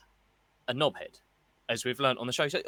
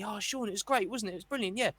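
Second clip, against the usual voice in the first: higher, fast gushing mimic; mock colleague gushing; 6 semitones higher, faster than usual. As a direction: lampoon a workmate who knows no names. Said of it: "people coming up to me".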